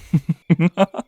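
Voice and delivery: a high, pleased little laugh; gleeful predator's giggle; delighted high giggle. Acted. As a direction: giggle with glee at the answer. Delighted by the captive's answer.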